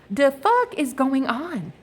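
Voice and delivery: high, drawn-out disbelief; retold exasperated bafflement; bewildered outrage. Acting out reaction to trolls.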